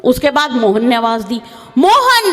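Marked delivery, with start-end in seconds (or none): 1.8-2.3 loud high call across the hills. Retold or imitated. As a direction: shout the name to the mountain. Calling out on a hilltop. (पहाड़ी पर ज़ोर से पुकारना)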